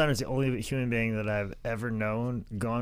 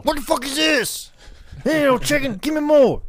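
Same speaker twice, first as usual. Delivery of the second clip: high, loud wrestler rage, demanding more food; Scott Steiner yelling; 13 semitones higher, 5 dB louder than usual. Retold or imitated. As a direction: yell like an enraged muscleman. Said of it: "Scott Steiner's yelling at you".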